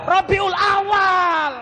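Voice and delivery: AWAL held long and high; drawn-out answer. Real, not acted. Preacher giving the quiz answer to mothers, drawn-out call. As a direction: call out Rabiul Awal high, stretching the last word.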